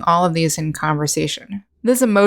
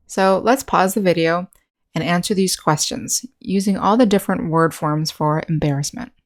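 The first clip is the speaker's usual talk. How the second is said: usual speaking voice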